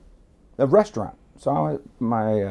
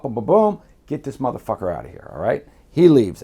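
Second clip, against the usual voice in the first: ordinary delivery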